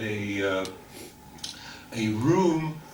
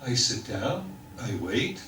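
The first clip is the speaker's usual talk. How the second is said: ordinary talk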